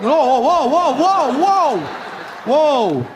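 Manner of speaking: high, repeated whoas; mock-alarmed interruption; alarmed halting. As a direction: cut off a misunderstanding fast. Halting a flood of Spanish.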